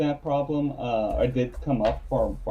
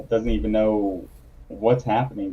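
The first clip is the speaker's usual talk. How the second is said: normal talking voice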